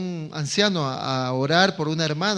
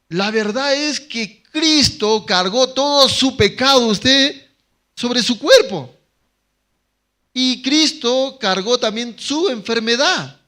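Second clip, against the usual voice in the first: louder, slower, higher preaching with full conviction; predicación con fuerza; 5 semitones higher, 6 dB louder, slower than usual. Acted. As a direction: proclaim it from the pulpit, building. Preaching the anciano's words. (predicación fuerte y lenta)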